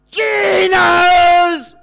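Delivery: big, high cheering yell of the shop name; kids' cheer. Retold or imitated. Grandkids cheering their Saturday treat with a loud, high yell. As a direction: shout it like an overjoyed grandchild at the end of the countdown. Kids finish weekday countdown cheer.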